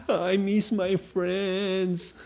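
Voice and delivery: put-on slow, soft whimpering child voice; mock whiny crying. Daughter whining to her parents at bedtime, mock crying. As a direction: imitate a sleepy child whining through tears.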